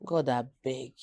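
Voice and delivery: quiet, low retold plea; inward plea. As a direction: drop to a soft mock prayer under your breath. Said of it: "I'm like".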